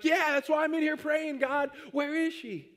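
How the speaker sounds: eager high plea. Retold or imitated. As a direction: squeak out the answer like a desperate kid. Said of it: "And I'm like".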